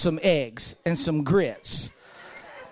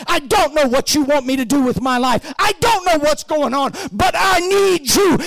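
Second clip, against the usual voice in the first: pleading prayer building; 4 semitones higher, 6 dB louder than usual. Acted. Preacher voicing an honest prayer to God, rising to a loud cry. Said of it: "you say to God".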